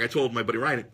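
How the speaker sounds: normal talking voice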